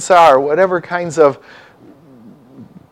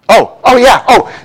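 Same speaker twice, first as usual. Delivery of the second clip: sudden realization; 8 semitones higher, 6 dB louder than usual. Real, not acted. Suddenly realizes Lou is there.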